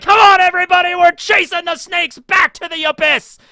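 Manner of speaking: high, loud battle cry; hammed-up shouting saint; rallying shout. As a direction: rouse the whole town with a mock war cry. Saint Patrick rallying the town.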